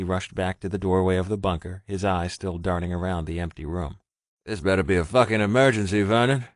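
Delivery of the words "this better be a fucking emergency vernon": deep, husky, gruff phone answer. A narrator's reading. Gruff stranger answering the phone, annoyed and husky. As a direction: growl it like you were woken for nothing. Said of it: "an extremely deep and husky voice answered the phone gruffly".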